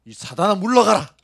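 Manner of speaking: loud, high rebuke of Satan; rebuking shout. Pretend. Preacher shows how the church would rebuke a costly demand, shouting. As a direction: cast out the devil at full voice. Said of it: "이렇게 안 하겠습니까?".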